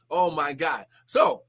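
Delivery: ordinary talk, nothing marked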